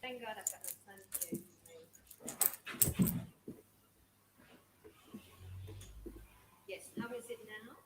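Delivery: very faint, far-off voice; faint voice on a weak connection; barely audible. Real, not acted. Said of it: "it needs to much louder".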